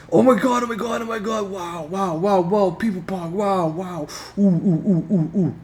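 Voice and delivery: hammed-up high shocked wows; over-the-top amazed reaction for camera; gobsmacked. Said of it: "I gotta do that for the YouTube".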